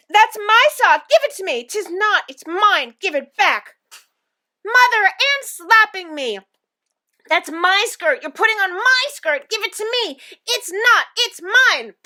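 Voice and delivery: high, loud bickering; squabbling children. A narrator's reading. Twins overheard fighting over socks and skirts, squabbling loudly. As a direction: argue shrilly like children fighting over clothes.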